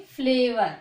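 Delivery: फ्लेवर said loudly, the half फ run quickly into ले; फ्लेवर spoken loudly with a quick half फ for the learners; clear teaching demo. Shown as a demonstration. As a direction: call out फ्लेवर clearly to the class. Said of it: "कैसे बोलोगे?".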